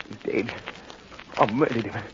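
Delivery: low, hushed realization; stunned horror. Acted. Henry alone over Albert's body, hushed and horrified. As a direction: let it sink in softly. Realizes he killed his friend.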